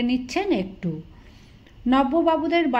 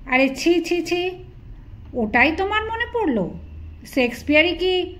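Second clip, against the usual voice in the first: higher, shocked tut-tutting; scandalised; 5 semitones higher than usual. Acted. Prudish friend tuts at the bawdy quote, scandalised. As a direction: cluck in prudish disgust.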